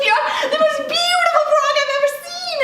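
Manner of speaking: very high, adoring squeal; gushing delight. A narrator's reading. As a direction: gush like a thrilled princess. Princess hugs the frog.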